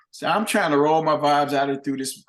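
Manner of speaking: ordinary delivery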